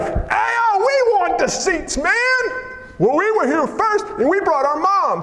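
high, put-on whiny voice; whiny entitled disciples. Preacher mimicking James and John demanding the best seats, high and whiny. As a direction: beg for the seats like spoiled kids.

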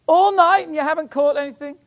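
high, loud, incredulous holler; shouting from shore. Retold or imitated. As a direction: shout back in disbelief across the water. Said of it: "he shouted out to them".

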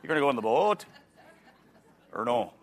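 stretched Wisconsin O in boat and no; exaggerated Wisconsin accent. Shown as a demonstration. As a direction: ham up the Wisconsin long O. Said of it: "I could make boat into, like, a three-syllable word".

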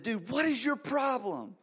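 high, slow, furious yell at the speeding driver. Retold or imitated. Pastor yelling at a speeding driver in his neighborhood, slow high furious yell. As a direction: bellow it out, drawn-out and outraged. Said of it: "as I yelled that".

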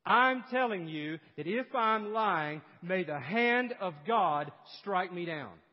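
loud, slow, solemn oath; calling down curses. Acted. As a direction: hammer out each phrase as a self-curse. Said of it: "Peter begins to call down curses on himself".

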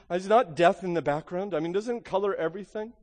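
normal talking voice

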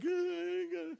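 high whimpering sob sounds, mock crying child; a boy sobbing over his lost shoe, imitated; imitating son's sobs. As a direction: whimper like a heartbroken five-year-old. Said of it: "my son is crying".

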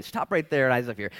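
normal talking voice

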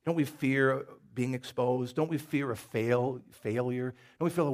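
ordinary talk

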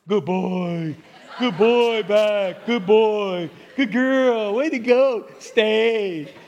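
pat the dog with your voice, chuckling; praising a dog. Pretend. Owner praising the dog that stays, laughing.

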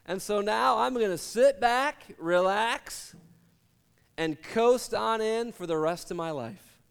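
slow, lazy, content drawl; lazy drawn-out boast. Acted. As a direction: stretch it out like he's already lounging. Rich man plans to coast.

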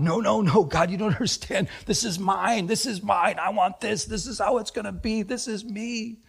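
acted clingy insistence; stubborn pleading. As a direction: plead like someone refusing to let go. Acting out clinging to penny.

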